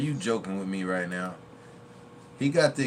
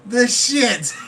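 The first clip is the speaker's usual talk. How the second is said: movie line impression; 9 semitones higher, 11 dB louder, much slower than usual. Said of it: "He said".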